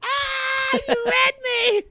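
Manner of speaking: very high, squealed mock cry; mock wail. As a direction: wail as if mortally exposed. Mock wail at being called out.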